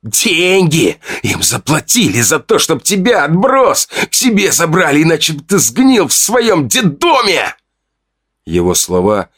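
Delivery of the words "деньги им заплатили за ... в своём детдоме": much higher, slowed, venomous shouting; spiteful yell. Acted. Fedya shouts at the narrator that he was taken in for money. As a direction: hurl the truth at him to hurt.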